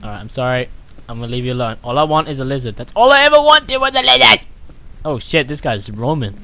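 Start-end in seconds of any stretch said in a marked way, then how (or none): 3.0-4.4 loud, high complaining cry; frustrated outburst. Real, not acted. Gamer crying out that he only wanted a lizard, loud.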